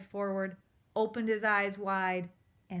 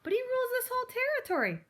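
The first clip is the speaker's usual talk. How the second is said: much higher, fast, squeaky mouse insisting; second take, higher and faster squeak; 5 dB louder, faster than usual. Acted. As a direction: rush it out like a panicky mouse. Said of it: "repeated take".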